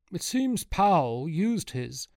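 high, slow character voice; a distinct high-pitched voice. A narrator's reading. Luca character voice.

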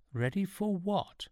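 high, slow wary question; suspicious. A narrator's reading. Sean questioning Laplace about the crystal, suspicious. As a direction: ask it full of suspicion. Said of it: "in a tone spatter-patterned with best grade suspicion".

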